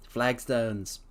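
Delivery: plain reading voice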